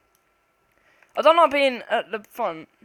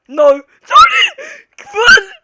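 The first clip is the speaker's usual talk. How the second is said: loud high laughing yell; panicked shout; 17 dB louder than usual. Real, not acted. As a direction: scream at your friend to run. Hears footsteps, flees.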